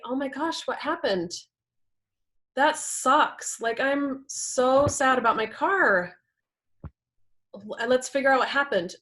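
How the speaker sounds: lightly acted upset partner, keeping it constructive; upset but kind. Partner hearing about the smashed car, upset but kind. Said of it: "Or they can come in and say".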